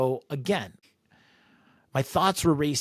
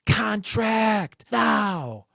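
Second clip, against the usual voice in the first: high, furious drill-sergeant yell, stretching the last order slowly; drill sergeant scream; 8 semitones higher, 3 dB louder, slower than usual. Retold or imitated. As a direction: bark orders nose to nose like a raging drill sergeant. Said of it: "Goes drill sergeant, screaming top of his lung".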